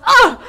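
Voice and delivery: high loud yelp at a punch; pained yelp. Acted. Tiefling punched in the face, high loud yelp. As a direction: cry out as the fist lands. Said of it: "Dal goes / socks him in the face".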